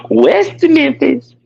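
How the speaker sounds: high, slow sneer on West Memphis; mocking the friend's hometown. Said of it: "mocking rival city".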